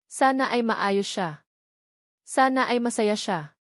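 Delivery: usual speaking voice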